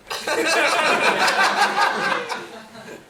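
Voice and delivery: high, loud burst of laughter. Real, not acted. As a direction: crack up loudly. Laughing at prize question.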